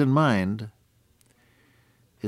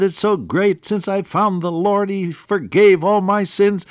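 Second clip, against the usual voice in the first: high-pitched jubilant outburst; rapturous, raised voice; happy shouting; 10 semitones higher, 4 dB louder than usual. Retold or imitated. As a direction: gush like a joyful new convert. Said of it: "just singing and shouting all the time".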